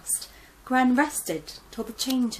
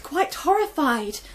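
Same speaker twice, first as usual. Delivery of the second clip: plain reading voice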